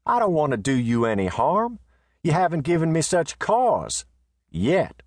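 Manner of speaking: high, calm warning with a pause before Yet; Riata, unbothered and warning. Acted. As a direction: let Yet land as a threat. Riata warns the messenger.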